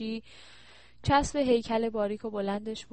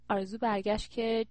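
ordinary delivery

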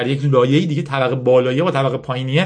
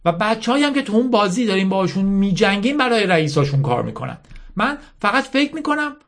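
higher-pitched, lightly acted earnest girl; earnest young Yasmin; 9 semitones higher than usual. Yasmin reasoning with her army's general, lightly voiced earnest girl. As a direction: make a heartfelt case in character. Acts Yasmin pleading with Mala.

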